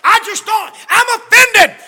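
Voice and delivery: very high, loud mock outrage; offended shriek. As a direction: screech it in wounded pride. Wife shrieks her offence.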